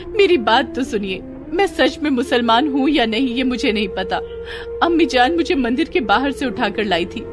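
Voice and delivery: pleading wife. A narrator's reading. Wife explains herself to her accusing husband, pleading. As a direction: plead to be heard.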